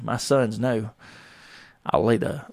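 usual speaking voice

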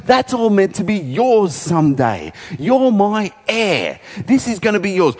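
a desperate king begging his son to understand; pleading King Saul. Acted. Saul appeals to Jonathan about his kingdom, high and pleading. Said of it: "He says".

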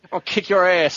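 gruff bad-dub thug voice; belligerent drunk. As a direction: threaten like a cheesy dubbed gangster.

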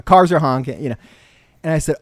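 normal talking voice

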